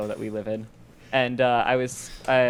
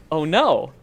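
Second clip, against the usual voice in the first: mock dawning horror, louder and higher by the end; retold dismay; 7 semitones higher, 6 dB louder than usual. Storyteller realizes the family friend means it, mock dismay. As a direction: realize it's serious, amused disbelief building.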